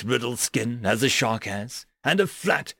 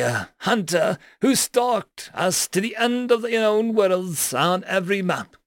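smug boar creature, higher voice; higher-pitched boar alien; 7 semitones higher, 3 dB louder than usual. Acted. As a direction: show off the axe, smug. Porcine alien presents axe.

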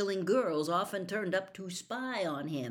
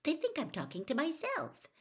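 put-on grumpy girl voice; 10 semitones higher than usual. A narrator's reading. Hermione grumpy.

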